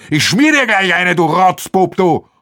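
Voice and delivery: threatening. Acted. Aschenwald threatens to slap Arno, loud and angry. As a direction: threaten him furiously.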